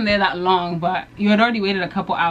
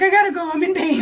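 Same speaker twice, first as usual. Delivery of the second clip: mocking friend; 9 semitones higher than usual. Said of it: "You're like".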